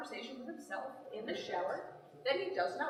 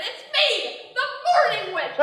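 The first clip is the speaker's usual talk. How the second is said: higher, louder cackling witch; morning witch; 8 semitones higher, 10 dB louder than usual. Retold or imitated. Husband playing the morning witch, high and loud. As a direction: announce yourself like a pantomime witch. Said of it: "He woke up and said".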